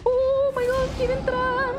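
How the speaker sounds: high, loud drawn-out alarm; alarmed squeal. Real, not acted. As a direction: cry out high in playful fright. Alarm as enemy tries getting in. (chillido agudo de alarma)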